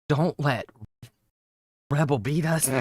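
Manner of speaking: low, subdued mock quote; Keemstar. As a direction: drop it low and soft, with a pause mid-phrase. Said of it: "Could you imagine if Keemstar said".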